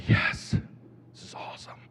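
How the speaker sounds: put-on breathy hushed awe over a child's card; hushed mock-amazed whisper; whispered praise. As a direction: whisper it so the kid can't hear. He whispers fake enthusiasm.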